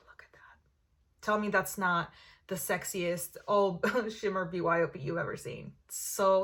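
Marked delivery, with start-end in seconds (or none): 0.0-1.2 sigh, then whisper in awe. Real, not acted. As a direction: breathe it out, slow and hushed. Gushing over the full palette.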